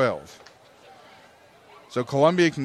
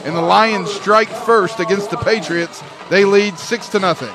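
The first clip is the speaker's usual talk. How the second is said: loud, high touchdown shout; touchdown call; 4 semitones higher, 5 dB louder than usual. Real, not acted. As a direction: belt out the score call. Announcing the touchdown.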